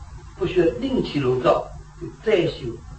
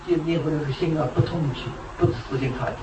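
usual speaking voice

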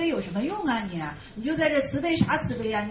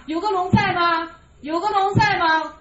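mocking challenge; 5 semitones higher, 6 dB louder than usual.

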